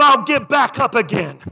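ordinary talk

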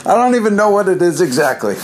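usual speaking voice

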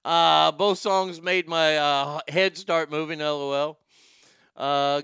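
ordinary talk, nothing marked

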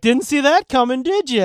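put-on cheeky, like a kid taunting the audience; lightly mocking kid. Kid in a comedy movie taunting the viewers, cheeky. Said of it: "the kid ... being like".

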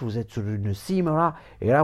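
normal talking voice

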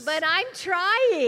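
loud, slow, earnest plea. Real, not acted. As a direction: insist loudly you're trying. Earnest plea after mistake.

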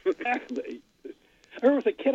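ordinary delivery

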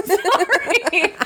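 high, giggling sorry; laughing apology. Real, not acted. Host apologizing to listeners through laughter.

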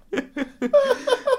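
loud, high laughing burst; high burst of laughter. Real, not acted. Friend laughs out loud while telling the story.

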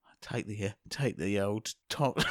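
low, hushed with a giggle; whisper-tell the sneaky bit. Pretend. Hushed conspiratorial setup.

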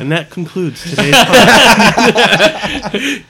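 laughing. Pretend. Jokey sign-off after bird call.

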